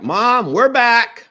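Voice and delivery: cheerful-volume holler, re-enacted; calling out. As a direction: announce your arrival to mom. Calling out on arriving home.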